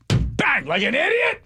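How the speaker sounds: high, loud mock of a friend; mocking impression. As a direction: squeak it like a cartoon idiot. Mocking Schmitty's slap again.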